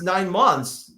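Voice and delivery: ordinary delivery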